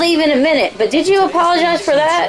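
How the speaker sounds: Little Mama. Acted. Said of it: "That was Little Mama".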